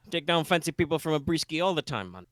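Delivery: a light Jamaican-bayou lilt, easygoing; vaguely Jamaican bayou accent. Acted. Boat captain greets the party, lightly Jamaican bayou accent. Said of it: "vaguely Jamaican bayou accent".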